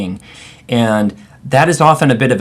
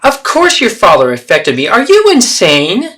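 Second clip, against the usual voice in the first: furious mother; 10 semitones higher, 7 dB louder than usual. Acted. Mother snapping at her daughter, furious. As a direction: hurl the question at her. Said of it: "So there you have the three role plays".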